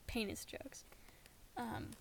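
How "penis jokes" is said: embarrassed whisper. Real, not acted. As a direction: whisper it sheepishly.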